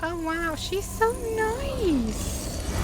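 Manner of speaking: normal talking voice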